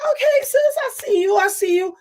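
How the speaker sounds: very high excited praise; hype compliment. Retold or imitated. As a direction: gush over her look. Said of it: "I was like".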